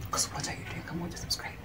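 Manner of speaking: soft whispered plea; whispering. Real, not acted.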